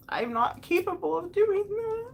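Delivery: high, upset confession; tearful admission. Real, not acted. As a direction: admit it on the edge of tears. Tearful admission of her own failing.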